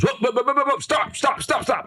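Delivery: very high, rapid-fire imitation; urgent interruption. Buddy halting the speaker mid-talk, high and urgent. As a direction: cut in with a high mock-panicked halt.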